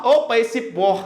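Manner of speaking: ordinary talk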